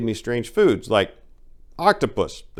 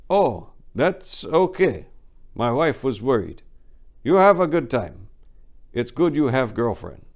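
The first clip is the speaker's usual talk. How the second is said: imitating the boss; 4 semitones higher than usual. Boss telling the teacher he approves of his girlfriend, lightly imitated broken English. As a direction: play the boss reassuring in choppy English, pitched up. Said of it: "And he was all like".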